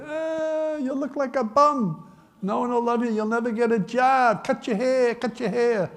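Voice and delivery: high-pitched, hammed-up parental scolding; mock a nagging parent in a high, whining voice. Parents to long-haired son, mock nagging. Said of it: "mothers and fathers would say".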